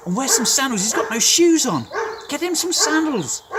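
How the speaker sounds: high, loud, joyful commands; father shouting happily to servants; excited calling out. Acted. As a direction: call across the house for sandals.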